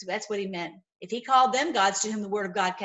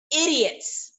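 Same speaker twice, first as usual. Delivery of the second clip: Idiots climbing higher and louder; 6 semitones higher, 4 dB louder, much slower than usual. Acted. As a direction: hammer the word idiots. Preacher mocking the gods.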